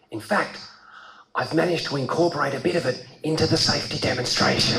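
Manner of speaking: lightly voiced flight attendant; stewardess confiding. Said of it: "And she said, and I'm not kidding".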